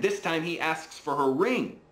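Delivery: high, excited bedtime storyteller; animated story narration. A narrator's reading. Dad tells his child of the king and the gold, animated high storytelling. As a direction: tell it with wide-eyed, high-pitched wonder.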